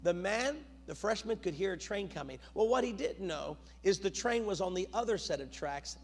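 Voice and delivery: normal talking voice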